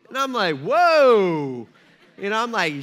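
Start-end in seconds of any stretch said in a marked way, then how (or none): 0.6-1.7 a loud laughing whoa; startled. Retold or imitated. Dad taken aback by his daughter, loud laughing whoa. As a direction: jolt back, amused.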